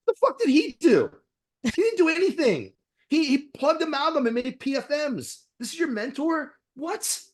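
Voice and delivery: high, disbelieving outburst at the mentor; incredulous. Real, not acted. Scoffing at a young dentist's mentor, high and incredulous.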